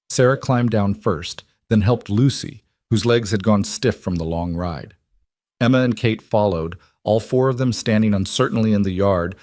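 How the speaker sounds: ordinary delivery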